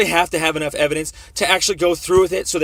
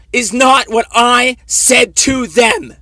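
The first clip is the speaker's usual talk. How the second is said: real angry shout, much higher and louder, slow and deliberate; upset shouting; 8 semitones higher, 9 dB louder, much slower than usual. Real, not acted. [name] shouting at the officer that they're lying to him, angry and slow. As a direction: hammer every word out in fury. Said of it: "There's no reason to get upset here".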